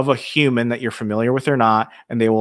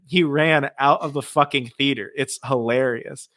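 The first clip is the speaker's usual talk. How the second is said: ordinary talk, nothing marked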